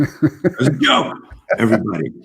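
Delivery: high, loud laughing through the words. Real, not acted. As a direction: laugh while insisting it was a joke. Host laughing off his own joke.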